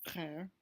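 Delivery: frère with the throaty French R and open è; French word. Shown as a demonstration. Teacher giving a practice word, French pronunciation. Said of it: "the French word for brother".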